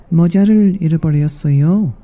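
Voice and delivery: high, quick mouse speaking Korean; fast little mouse in Korean. Acted.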